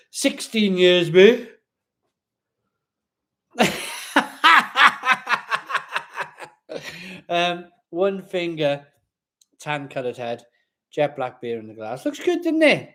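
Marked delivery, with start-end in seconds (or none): 0.1-1.5 slow, then a loud mate; joking outrage at his own age; mock indignant. Real, not acted. 3.6-6.5 loud high laugh at himself; big belly laugh; hearty laugh. Real, not acted. 12.0-12.9 much higher, delighted; admiring the pint, delighted. Real, not acted. Admiring the beer.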